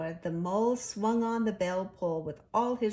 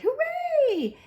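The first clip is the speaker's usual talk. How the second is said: high, loud triumphant cheer; high loud whoop; cry of joy; 9 dB louder than usual. A narrator's reading. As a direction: shout with delight. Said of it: "Suddenly the rat cried".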